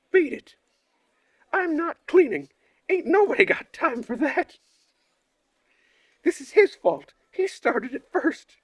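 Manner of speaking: put-on high, loud whiny kid shouting; shrill-pitched shouting Uliana refusing; bratty yelling girl. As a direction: yell it like a defiant brat.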